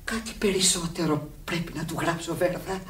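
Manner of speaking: soft unvoiced whisper by the bed. Friend at the sickbed, whispering gently. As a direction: hush it so she can rest.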